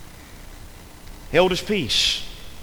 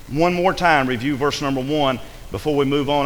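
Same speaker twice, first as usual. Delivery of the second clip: ordinary delivery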